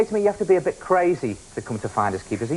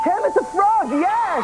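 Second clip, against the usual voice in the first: excited reveal; 9 semitones higher, slower than usual. Acted. Host announces correct answer.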